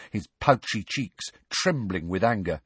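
usual speaking voice

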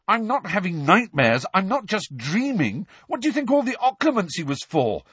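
high, loud, furious insistence; angry shouting. A narrator's reading. Harry yelling at Hermione that Sirius is real, loud and high. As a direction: hammer every line home in anger. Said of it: "Harry shouted at her".

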